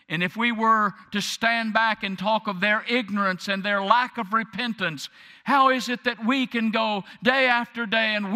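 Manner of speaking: high, loud, rising pulpit cry; fiery preaching. Acted. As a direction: preach it out at full force to the congregation. Preacher builds to full force.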